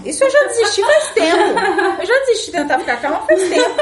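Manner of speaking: high, fast, laughing. Real, not acted. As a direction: rattle it off while laughing. Laughing about giving up calm.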